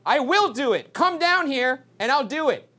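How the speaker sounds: high-pitched bravado; defiant challenge. Real, not acted. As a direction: dare it to show itself. Daring the ghost.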